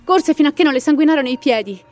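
dramatic storyteller reading a ghost legend; story narration. A narrator's reading. Narrator telling listeners the Llorona legend, dramatic storytelling.

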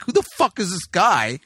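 retold exasperated club owner; fed up and incredulous, acting it out; irritated outburst. Irate club owner in retold story.

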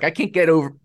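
ordinary talk

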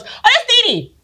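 high, sing-song office lady voice; office ladies. Retold or imitated. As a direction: imitate gossipy staff chiming in. Office staff recognizing her.

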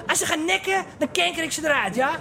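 high, fast, rough driver voice; gruff taxi driver. Retold or imitated. Taxi driver asks what is wrong with the boys, rough and fast. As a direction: bark it like an annoyed cabbie. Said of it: "Die taxichauffeurs zijn meteen".

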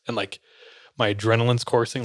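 normal talking voice

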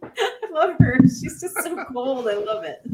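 high, laughing through the words; giggling with delight at a ruthless heroine. Real, not acted. Fan laughing about her favourite character, laughing. Laughing while praising Sonya.

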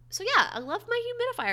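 high squeaky excitement; high squeal. Acted.

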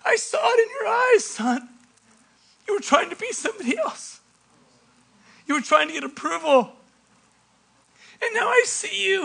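high, earnest fatherly plea; heartfelt father. Acted. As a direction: plead tenderly with a lost son. Father's heartfelt words to returned son.

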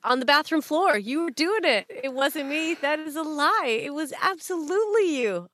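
high, indignant back-and-forth; mock outraged accusation. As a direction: act out a heated accusation and denial, pitched right up. Acted quarrel with denying culprit.

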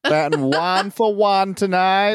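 high, hammed-up announcer call; mock baseball announcer. Co-host riffing as a terrible baseball announcer, hammed-up. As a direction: call it like a bad play-by-play guy. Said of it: "worst baseball announcer".

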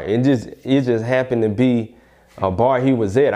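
ordinary talk, nothing marked

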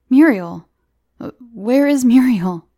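startled and frightened, calling for her; sudden fear. Acted. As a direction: fear rising as he asks. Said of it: "his heart leaping in sudden fear".

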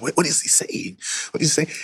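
a lightly voiced uncomfortable churchgoer; mock confused listener. Churchgoers mutter confused about the preacher, hushed puzzled murmur. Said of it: "making some people uncomfortable".

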